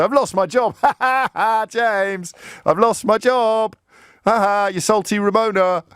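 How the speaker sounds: high, mocking gloat with jeering laughs; gloating caller. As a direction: crow in a high-pitched caller's voice. Acts out gloating caller ringing in.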